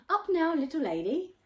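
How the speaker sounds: loud, high cheerful nanny call; loud sing-song wake-up call; cheery wake-up call. A narrator's reading. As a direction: burst in and call her out of bed. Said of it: "came bursting through my bedroom door".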